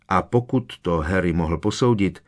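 ordinary delivery, nothing marked

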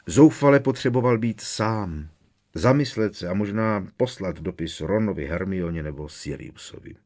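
plain reading voice

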